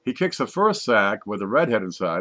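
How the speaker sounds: normal talking voice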